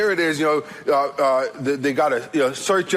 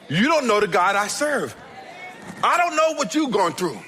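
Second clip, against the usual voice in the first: raised pitch, emphatic gospel declaration; high, forceful preacher's delivery; impassioned preaching; 5 semitones higher than usual. Acted. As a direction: proclaim it to the congregation, drawing out key phrases. Preacher rises into full declaration.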